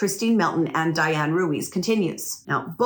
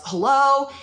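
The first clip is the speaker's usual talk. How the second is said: calling out into the house, louder and higher; retold yell; 6 semitones higher, 4 dB louder than usual. Homeowner calling into her house, a mild retold yell. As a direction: call out a wary hello into an empty house. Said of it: "she yells".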